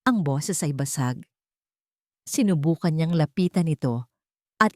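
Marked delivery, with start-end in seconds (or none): none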